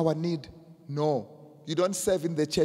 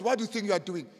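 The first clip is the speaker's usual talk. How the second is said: normal talking voice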